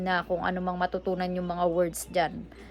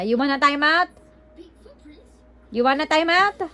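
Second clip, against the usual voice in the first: higher, louder warning question; threatening time out; 7 semitones higher, 4 dB louder than usual. Real, not acted. Mom warning her son with a time out. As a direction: warn him, rising sharply.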